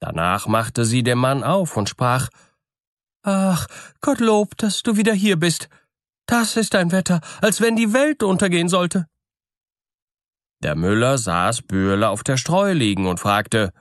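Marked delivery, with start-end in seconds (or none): 3.2-9.1 much higher-pitched wife's voice; high-voiced wife, exclaiming with relief; miller's wife greeting husband. Miller's wife lets her husband in, relieved high voice.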